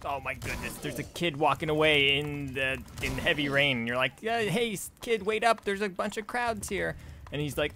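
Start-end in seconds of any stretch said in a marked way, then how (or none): 4.2-7.0 put-on high-pitched, worried-sounding call after a kid; mock calling out. Adult to kid walking off, mock high-pitched calling. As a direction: imitate calling after someone, pitched well up.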